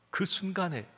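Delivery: ordinary delivery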